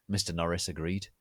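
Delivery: ordinary delivery, nothing marked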